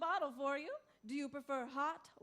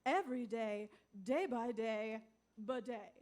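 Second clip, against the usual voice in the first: upbeat infomercial pitch; ad read; 3 semitones lower, 4 dB quieter than usual. Acted. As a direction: sell the product with a grin.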